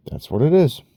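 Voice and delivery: emphatic exclaimed version; high loud take. Shown as a demonstration. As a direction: fire That's what it is up high and loud.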